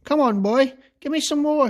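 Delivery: a demanding crone's mild yell. A narrator's reading. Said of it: "she yelled".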